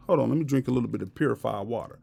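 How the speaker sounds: ordinary talk, nothing marked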